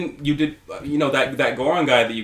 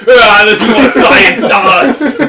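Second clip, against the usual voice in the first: loud, high, slow laughing cry; hammed-up death yell; dying king shouting at science; mock dying scream; 7 semitones higher, 11 dB louder, much slower than usual.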